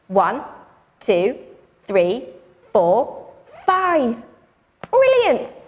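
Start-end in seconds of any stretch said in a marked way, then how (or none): 4.9-5.6 high bright praise; excited. Real, not acted. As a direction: cheer them on big. Praising audience.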